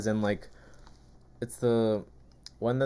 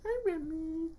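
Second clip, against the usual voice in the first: much higher, sing-song pet voice; 10 dB quieter than usual. Real, not acted. Owner greeting her dog Remy in high baby talk.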